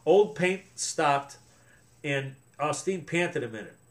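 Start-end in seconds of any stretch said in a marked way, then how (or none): none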